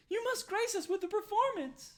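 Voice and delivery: very high, loud, squeaky hostess voice; squeaky, loud Darnsky serving the party; eager barmaid. Acted. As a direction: push the drink on them with bubbly certainty. Darnsky begs for song.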